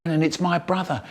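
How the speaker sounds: usual speaking voice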